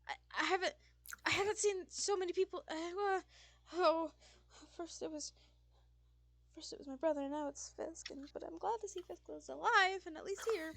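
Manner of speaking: high, loud, flustered character voice; excitable Sullivan, overwhelmed. Acted. Sullivan overwhelmed at seeing brother and roommate, high and flustered. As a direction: stumble along high and worked up.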